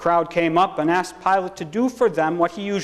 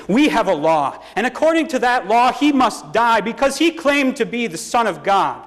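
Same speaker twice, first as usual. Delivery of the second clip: higher, forceful crowd argument; insistent; 7 semitones higher, 3 dB louder than usual. A narrator's reading. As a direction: insist on the law, voice raised.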